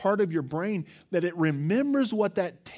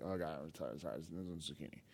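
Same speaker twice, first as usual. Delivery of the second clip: tired mock groan, much softer and lower; weary kid; 12 semitones lower, 15 dB quieter than usual. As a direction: sigh it out like a kid sick of zucchini. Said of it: "we was like".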